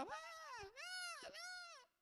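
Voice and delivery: high mock wail of a crying child; long, squealed fake toddler cry; imitated child crying. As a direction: imitate a kid bawling, high and drawn out. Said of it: "A criança tá lá".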